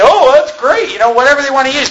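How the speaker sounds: a hint of a cheerful naive hearer; mock listener. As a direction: imitate a naive churchgoer. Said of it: "you say".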